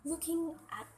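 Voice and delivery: "Looking" lifted high and stressed, then falling. Shown as a demonstration. Teacher contrasting looking with reading, stressed "Looking". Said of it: "looking is different from reading".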